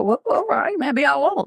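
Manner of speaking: high, coy teasing; playful mock retreat. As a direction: tease them by backing down. Teasing clients back.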